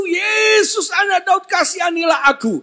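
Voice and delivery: high, desperate cry for mercy; Bartimeus. Acted. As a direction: call out to Jesus with all your strength. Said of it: "segenap kemampuannya berseru".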